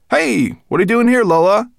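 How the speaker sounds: high, loud, surprised question; startled. A narrator's reading. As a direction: blurt it out in alarm. Boy startled by the stowaway rat.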